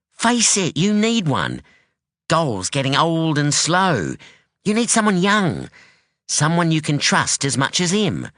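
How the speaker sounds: a sharp, wary daughter; hint of a guarded young woman; young woman Anyi. Anyi voiced in story.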